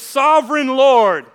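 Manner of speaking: loud, slow, drawn-out protest; a complaining believer; indignant prayer. Pretend. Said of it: "now all of a sudden it's".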